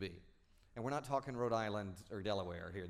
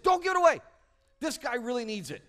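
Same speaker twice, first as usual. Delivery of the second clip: much higher, loud urgent shout; 10 semitones higher, 11 dB louder than usual. Retold or imitated. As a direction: plead hard for the stranger. Clerk urging coworker.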